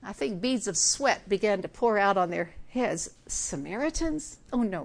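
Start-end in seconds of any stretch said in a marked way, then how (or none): none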